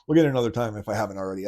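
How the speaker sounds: ordinary delivery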